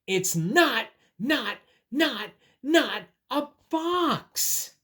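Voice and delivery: high, emphatic, each NOT separated; drawn-out, fed-up bunny protest; frustrated. Acted. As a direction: hammer every "not" in exasperation. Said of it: "Oh, he's frustrated".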